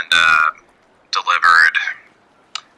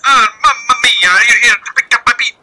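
put-on Italian accent. Italian dragonborn voiced.

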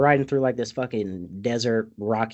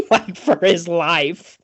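slow, laughing and louder; 7 semitones higher, 7 dB louder, slower than usual. Real, not acted. Describing Sancho's desperate dance, laughing hard. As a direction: barely get the words out for laughing.

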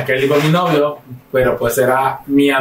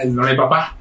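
usual speaking voice

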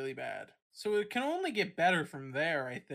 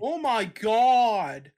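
shocked shout; 4 semitones higher, 12 dB louder, much slower than usual. Real, not acted. Reacts to shocking news.

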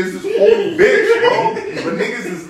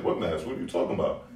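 playing dumb; 14 semitones lower, 11 dB quieter than usual. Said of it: "I was like".

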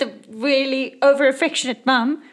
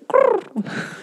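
pigeon growl; 11 semitones higher than usual. Acted. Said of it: "And the pigeon goes".

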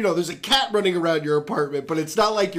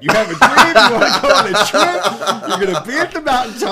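mock Martin Luther King speech; 3 semitones higher, 8 dB louder than usual. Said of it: "you're just quoting Martin Luther King".

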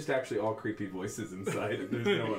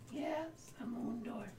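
creepy whisper; 14 semitones higher, 8 dB quieter, much slower than usual. Acted. Oracle answers in whisper.

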